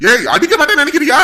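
loud, furious threat; husband yelling that he will hit her; angry shout. Acted. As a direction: roar at her as if about to strike.

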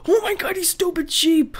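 high, loud shout at the sheep; frustrated outburst; exasperated yell. Real, not acted. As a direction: scream at the dumb sheep.

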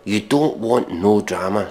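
ordinary talk, nothing marked

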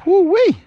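high, loud woo-wee whoop; excited whoop. Real, not acted. Angler whooping over his catch, triumphant. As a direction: let out a triumphant holler.

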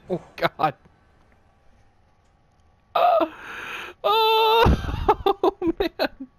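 high, loud rattled laughter; nervous laughing. Real, not acted. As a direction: laugh through the shock of a scare. Panicked laughter after the scare.

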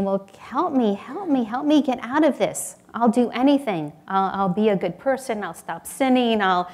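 loud begging, acted out; mock desperate pleading. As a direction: plead for rescue with a string of promises. Jack pleads, rattling off promises.

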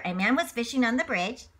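plain reading voice

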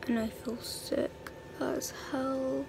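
hushed whisper, mum asleep nearby. Real, not acted. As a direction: keep it to a whisper so nobody wakes. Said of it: "I have to whisper 'cause my mum's asleep".

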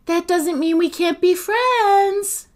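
high, loud call. A narrator's reading. Sophie calls through the door to departing Agatha, loudly. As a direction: call after someone walking away. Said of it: "Sophie called".

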